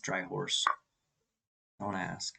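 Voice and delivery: ordinary delivery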